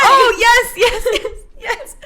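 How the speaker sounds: high, loud tearful laughing yes. Retold or imitated. Bride accepting the proposal, high laughing yes. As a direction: gush yes through laughter. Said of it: "I'm like".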